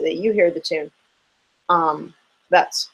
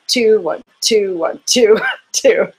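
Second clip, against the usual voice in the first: "two" punched louder with a falling tune, "one" light after it; accented counting; 3 semitones higher, 5 dB louder, much slower than usual. Shown as a demonstration. Teacher counts Saint Antoine accents, laughing at the end. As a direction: lean hard on each "two", then crack up laughing. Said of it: "put that Saint Antoine emphasis".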